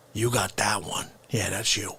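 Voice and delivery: usual speaking voice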